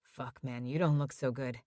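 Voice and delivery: queasy friend muttering beside the hospital bed; muttered. Acted. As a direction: grumble it half to himself. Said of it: "Jamie muttered".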